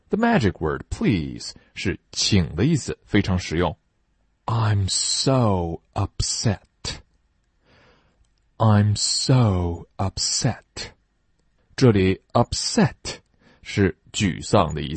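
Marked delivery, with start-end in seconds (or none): 4.5-6.6 slow, even model sentence; slow teacher model: I'm so upset; slow model reading. Shown as a demonstration. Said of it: "model sentence for repeating". 8.6-10.6 slow, even model sentence; slow teacher model: I'm so upset; slow model reading. Shown as a demonstration. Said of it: "model sentence for repeating".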